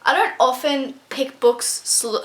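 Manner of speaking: normal talking voice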